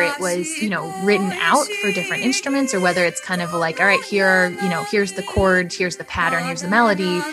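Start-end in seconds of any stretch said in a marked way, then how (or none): none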